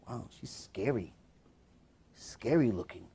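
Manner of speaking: very soft, low aside; imitated whisper. Girlfriend to boyfriend about his mother, hushed whispering. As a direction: murmur it low so only he hears. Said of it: "the girl whispers to him".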